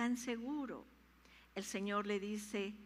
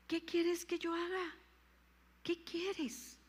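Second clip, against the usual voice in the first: higher pleading question; intense plea; 7 semitones higher, slower than usual. Acted. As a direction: press the question upward. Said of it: "decirle cada mañana". (súplica aguda)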